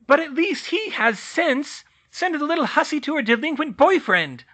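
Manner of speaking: high, loud scornful tirade; spiteful rant. Acted. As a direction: spit insults at the girl. Muriel ranting at Hermione.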